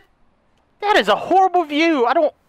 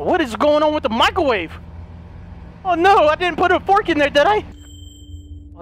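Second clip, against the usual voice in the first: normal talking voice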